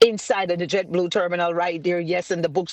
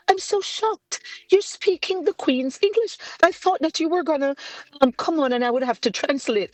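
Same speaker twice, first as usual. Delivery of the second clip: much higher, prim put-on voice; mock shocked interviewer; 8 semitones higher than usual. Author mimicking the surprised interviewer, much higher and prim. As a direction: mimic the stunned gentleman.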